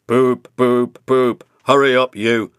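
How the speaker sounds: loud cross booming horn voice; big cross engine. A narrator's reading. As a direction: boom impatiently at Thomas. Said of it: "said Gordon crossly".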